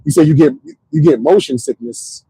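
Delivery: ordinary talk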